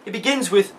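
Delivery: ordinary delivery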